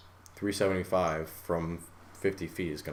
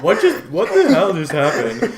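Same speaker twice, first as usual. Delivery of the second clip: loud laughing confusion; bewildered, half laughing; a bewildered laughing cry; shocked; 7 semitones higher, 9 dB louder than usual. Retold or imitated. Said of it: "I'm like".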